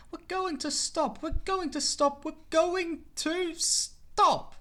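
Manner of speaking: high, slow, dragging coach voice; slowing coaches. Acted. Coaches pulling back on James, high and dragging. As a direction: coaches groaning as they brake the engine.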